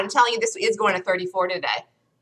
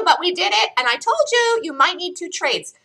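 ordinary talk

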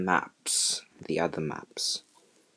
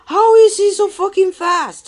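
furious yell; 8 dB louder than usual. Real, not acted. Repeated rant.

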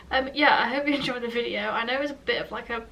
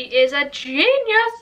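gushing praise; 3 semitones higher, 8 dB louder, much slower than usual. Real, not acted. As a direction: proclaim it in big drawn-out delight.

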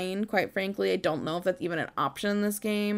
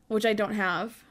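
ordinary talk, nothing marked

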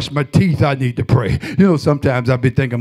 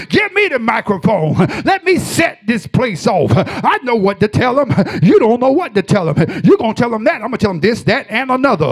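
preaching at full force; 7 semitones higher than usual. Acted.

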